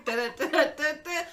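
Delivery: loud, high mock theme and welcome; hammed-up podcast intro. Host covering for missing music, hammed-up announcer. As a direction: belt out a fake show opening.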